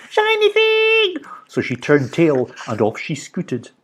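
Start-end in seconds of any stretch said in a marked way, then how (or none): 0.1-1.2 very high, slow goblin squeal; greedy goblin babble; babbling goblins. A narrator's reading.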